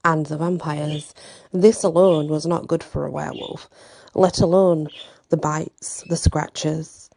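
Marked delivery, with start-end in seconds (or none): none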